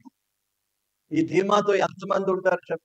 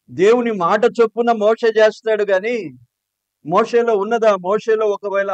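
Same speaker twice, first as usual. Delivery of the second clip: loud, higher sermon delivery; full-force preaching; 5 semitones higher, 7 dB louder than usual. Acted. Preacher rousing his congregation at full force. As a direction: press the point hard to the congregation.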